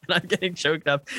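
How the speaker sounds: laughing. Real, not acted. Laughing while recalling crying.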